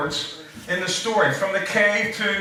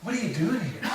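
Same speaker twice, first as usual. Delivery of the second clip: softer, inward take with stress on are; softer inflection; 2 semitones higher, 6 dB quieter than usual. Shown as a demonstration. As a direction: ask it gently, leaning on "are", almost to yourself. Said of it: "maybe you could change your inflections".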